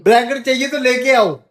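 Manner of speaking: loud, high-pitched retort; exasperated retort. Real, not acted. Husband refusing wife's demand for a blanket, exasperated. Snapping back in blanket quarrel.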